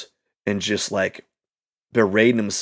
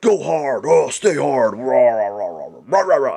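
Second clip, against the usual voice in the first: lightly voiced tough-guy hype chant; a weak mock drill-sergeant pep yell; David Goggins impression. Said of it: "I can't do a good David Goggins".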